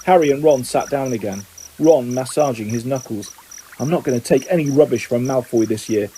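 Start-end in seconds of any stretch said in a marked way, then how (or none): none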